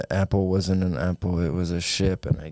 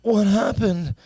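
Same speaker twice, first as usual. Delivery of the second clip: dazed waking; 12 semitones higher, slower than usual. Acted. James wakes up.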